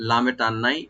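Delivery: usual speaking voice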